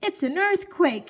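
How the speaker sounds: high frightened cry of earthquake; wife shouting in panic; panicked shout. Acted. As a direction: shout in alarm. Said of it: "shouted Missus Watson".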